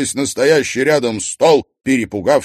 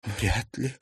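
tearful admission; 10 dB quieter than usual. A narrator's reading. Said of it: "призналась та".

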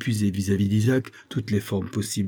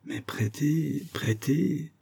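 slow, soft, higher, full of doubt; reluctant; 6 semitones higher, 5 dB quieter, much slower than usual. A narrator's reading. Usurer balking at lending to the governor, reluctant. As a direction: draw the word out unwillingly.

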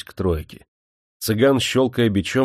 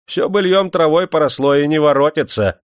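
loud, raised-pitch rebuke; irritated scolding, building higher and louder; angry; 6 semitones higher, 6 dB louder than usual. A narrator's reading. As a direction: cut off the reminiscing crossly. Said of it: "сердито сказал он".

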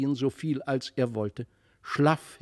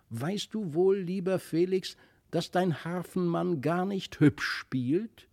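put-on higher-pitched child's voice; little girl complaining; 6 semitones higher than usual. A narrator's reading. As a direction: voice a little girl gently finding fault.

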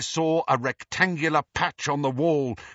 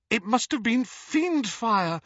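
frightened whimper, higher; 9 semitones higher than usual. A narrator's reading. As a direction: whimper while staring at the pieces. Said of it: "whimpered Hermione".

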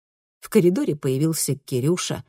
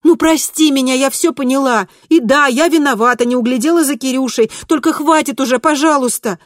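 loud, high, frantic appeal; desperate pleading; 9 semitones higher, 7 dB louder than usual. A narrator's reading. Kristina apologizing to Mom who ignores her, desperate pleading. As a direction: beg loudly for it to stop.

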